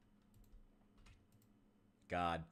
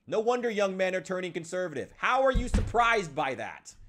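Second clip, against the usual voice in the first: exasperated; 8 semitones higher, 6 dB louder than usual. Real, not acted. Venting frustration at Democrats.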